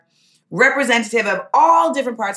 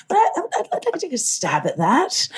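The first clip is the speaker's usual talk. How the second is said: faux British accent; 5 semitones higher, 4 dB quieter than usual. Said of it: "the actor in me naturally is like".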